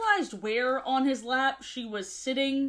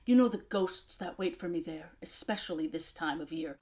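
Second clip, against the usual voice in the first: lower, soft, sinking gloom; brooding Silas; 7 semitones lower, 5 dB quieter than usual. Retold or imitated. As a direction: play him moody and tragic.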